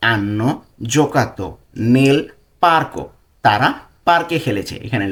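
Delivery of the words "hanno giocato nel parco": slow dictation. Shown as a demonstration. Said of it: "পার্কো, okay?".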